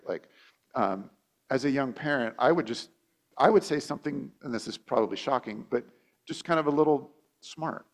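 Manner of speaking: ordinary delivery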